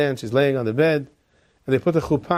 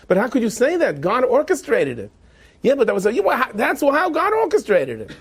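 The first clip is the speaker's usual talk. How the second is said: high-pitched, heated protest; insistent arguing; 9 semitones higher than usual. Acted. Wife arguing God made the match, insistent. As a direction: push the point in a rising voice. Argument over the marriage.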